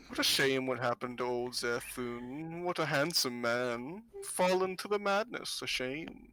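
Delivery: lilting old lady's mock lament, drawn out; wistful old fortune-teller. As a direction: savour the gossip with a touch of pity.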